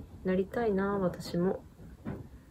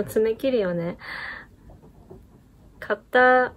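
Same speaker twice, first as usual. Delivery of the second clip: amateur Kansai dialect. Shown as a demonstration. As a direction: try out your best imitation Kansai-ben. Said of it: "ちょっと喋ってみてもいいですか".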